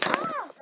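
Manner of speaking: short high squeal of excitement; excited squeal. Real, not acted. Vlogger reaching her last question, squealing with excitement. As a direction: let out a sudden thrilled squeal.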